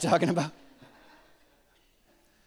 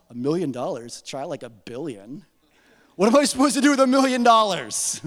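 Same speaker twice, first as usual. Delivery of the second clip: mock kid greed; 4 dB louder than usual. Kid self mocks modest amount.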